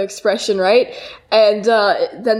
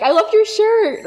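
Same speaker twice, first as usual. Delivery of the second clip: put-on high, slow girly gush; high sweet compliment; 11 semitones higher than usual. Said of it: "they'll be like".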